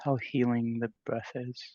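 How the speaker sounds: unhurried, spacious meditation voice with long pauses; guided meditation. Real, not acted. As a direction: gently guide the group into stillness. Leads a meditation.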